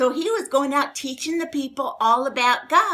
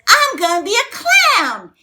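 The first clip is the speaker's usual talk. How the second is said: put-on little boy's voice; 8 semitones higher, 9 dB louder than usual. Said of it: "And the other little boy".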